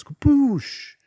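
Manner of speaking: high, loud explosion sound; mind-blown boom. Retold or imitated. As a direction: shout a cartoon kaboom. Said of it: "my own brain just goes".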